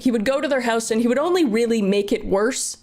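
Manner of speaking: normal talking voice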